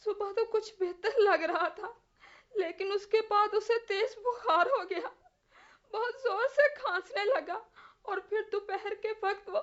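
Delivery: tearful. Acted. Mother recounts her son's death.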